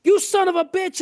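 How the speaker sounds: cursing up high, hammed-up rage; mock furious curse. The Suburban owner curses at the cart pusher, mock furious. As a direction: swear at the cart guy like he wrecked your truck. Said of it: "he's looking at me going".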